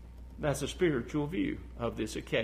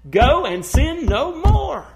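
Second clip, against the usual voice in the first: commanding Jesus; 12 semitones higher, 14 dB louder, slower than usual. Retold or imitated. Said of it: "That's why he says".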